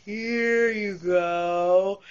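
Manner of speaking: very slow, drawn-out slow-motion line. Retold or imitated. Food runner handing over the vegetables, in slow motion. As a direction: stretch every word like a replay. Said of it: "it's like slow motion in my brain".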